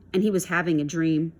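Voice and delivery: plain reading voice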